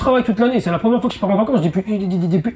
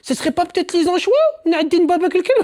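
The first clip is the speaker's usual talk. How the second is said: high puzzled exclamation; 10 semitones higher than usual. Retold or imitated. Man biting salty pizza, high baffled voice. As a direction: pitch up, baffled and suspicious.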